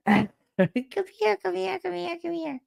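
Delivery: very high, cooing pet call; animal baby-talk voice. Retold or imitated. Woman running around after her duck, high baby-talk calling. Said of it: "That voice".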